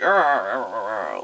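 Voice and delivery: loud "arr" noises tumbling downward; blustery imitated grumbling in a bad brogue; mock-Irish growling gibberish. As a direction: growl like a cartoon Irish sailor. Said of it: "literally that kind of".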